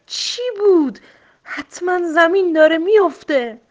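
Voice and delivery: high, loud panicked cry; frightened shout. A narrator's reading. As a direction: yell it as if the ground is falling. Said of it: "فریاد زد".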